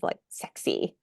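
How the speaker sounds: sexy breathed out, barely voiced, a little embarrassed; embarrassed whisper. Real, not acted. Shy actress saying sexy to the interviewer, whispered. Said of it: "And even to say sexy".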